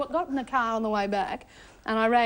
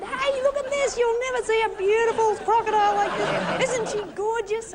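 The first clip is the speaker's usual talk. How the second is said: gushing, high-pitched showing-off, much higher than usual; excited imitation; 9 semitones higher than usual. Reporter imitating Steve showing off his crocodile, excited. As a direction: imitate an overexcited animal lover, high and quick. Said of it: "you were so excited".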